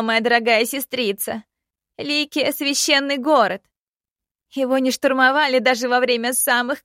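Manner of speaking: high, loud insistent argument; heated insistence. Acted. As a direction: insist hard the city is safe. Princess argues heatedly.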